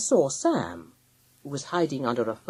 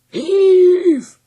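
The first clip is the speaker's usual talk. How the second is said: high, loud drawn-out command; long hauling shout; leader's cry; 14 dB louder than usual. A narrator's reading. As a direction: bellow the word to a team. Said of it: "cried the leader".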